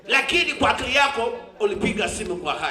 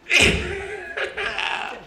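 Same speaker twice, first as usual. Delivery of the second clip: shouted laugh; 10 semitones higher than usual. Real, not acted. Sees the federation president.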